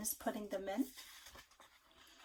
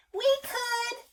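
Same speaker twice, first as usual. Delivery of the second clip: very high, louder chorus voice; animals together; 14 semitones higher, 6 dB louder than usual. A narrator's reading. Parent reading to child, the animals answer. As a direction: cheer it like all the animals at once. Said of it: "said rabbit, duck, dog".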